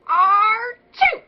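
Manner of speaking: loud, high hillbilly sneeze; a big rowdy country-boy achoo. Shown as a demonstration.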